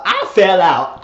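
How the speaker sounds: loud, high exclamation; floored excitement. Real, not acted. Viewer reacting to the shocking name reveal, loud excitement. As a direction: burst out, floored by the twist.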